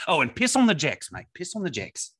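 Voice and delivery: Australian accent, high and hammed-up; laconic outback drawl. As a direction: play an ocker Aussie bloke, Australian accent. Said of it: "Let me tell you just what I did today".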